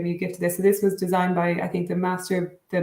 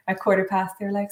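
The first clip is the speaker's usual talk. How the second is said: ordinary delivery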